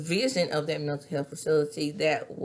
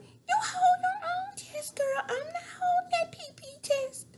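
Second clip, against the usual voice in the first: squealing Melanie through laughter. Retold or imitated. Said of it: "Melanie's like".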